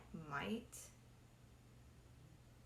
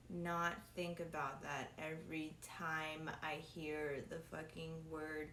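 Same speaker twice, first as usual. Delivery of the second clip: ordinary talk, nothing marked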